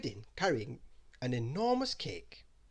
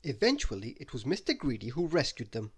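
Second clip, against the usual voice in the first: ordinary delivery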